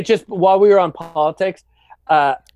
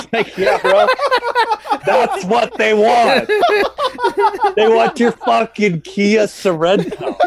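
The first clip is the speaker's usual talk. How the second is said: laughing mockery; 7 semitones higher, 3 dB louder, slower than usual. Real, not acted. Mocking the old man's complaint.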